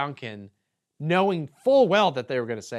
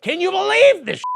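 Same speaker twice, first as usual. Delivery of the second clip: high, loud hammed-up indignant squeal; mock indignation; 11 dB louder than usual. Parodying the brand crying censorship, high and loud. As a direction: squeal it like a melodramatic victim.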